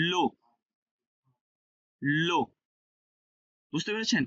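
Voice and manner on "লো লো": "লো" with a clear long o; o vowel. Shown as a demonstration. Teacher showing how the letter sounds, "লো" with an o. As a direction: say "লো" as a lone syllable ending in o. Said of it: "উচ্চারণ হবে ওকার".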